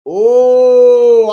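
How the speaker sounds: very loud, high, held exclamation; long oh. Real, not acted. Cheering Whitney's point, a long loud oh. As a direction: belt out a long impressed "oh".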